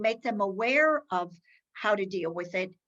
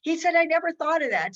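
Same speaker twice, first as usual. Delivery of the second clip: higher and louder, eager discovery; excited expert; 7 semitones higher, 6 dB louder than usual. Retold or imitated. Expert on self-driving cars, excited high and loud. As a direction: retell his delighted surprise. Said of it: "he got all excited".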